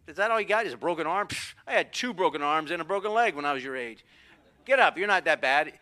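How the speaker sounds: lightly acted unimpressed father; tough dad. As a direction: scoff like a dad unmoved by tears. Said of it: "What do dads do?".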